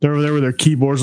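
ordinary talk, nothing marked

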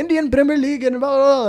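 fast, high mock prattle; rambling chatter. Ali starts on the Premier League, rambling fast. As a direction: rattle it off in a high gabble. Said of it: "starts talking about the Premier League".

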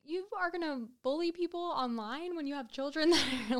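high, disbelieving rant with a laugh; outraged disbelief breaking into a laugh; incredulous. Retold or imitated. Incredulous rant about creepy man.

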